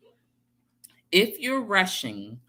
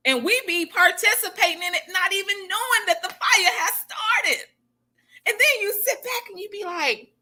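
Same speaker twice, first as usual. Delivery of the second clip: raised preaching pitch; 11 semitones higher than usual. Real, not acted. Preaching with rising intensity.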